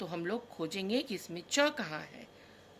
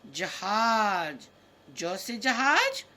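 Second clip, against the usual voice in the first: very slow, louder drawn-out word; louder, very slow teacher naming; slow, stretched naming; 6 dB louder, much slower than usual. Shown as a demonstration. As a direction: draw each syllable out for the kids. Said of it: "teacher stretching the word for children".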